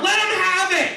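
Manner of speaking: high, loud holler to another room; hammed-up dismissive yell; yelling back. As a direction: shout it back, desperate to be left alone. Husband yells back to wife.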